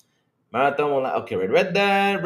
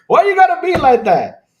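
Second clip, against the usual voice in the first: high, loud mock-hurt protest; whiny outcry; 12 semitones higher, 9 dB louder than usual. Real, not acted. Streamer protesting a viewer's jab, high and loud. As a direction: wail it like you've been betrayed.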